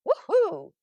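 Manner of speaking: high, loud cheer of delight; a big happy woohoo; excited whoop. Acted. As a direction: whoop with joy at the parcel. Cheering at the delivery.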